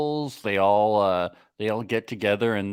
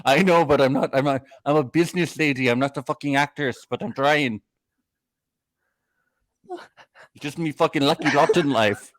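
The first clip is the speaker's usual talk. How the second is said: higher, bad Irish accent; [name], Irish accent; 5 semitones higher than usual. [name] saying she's no actress, mock Irish accent. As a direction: plead in a put-on Irish brogue. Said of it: "I got my Irish accent".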